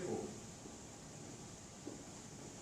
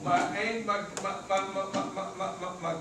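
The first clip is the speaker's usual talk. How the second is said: real stammer on "my"; stuck on "my", repeating it in quick bursts; stuttering. Real, not acted. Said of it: "The fact that I stutter".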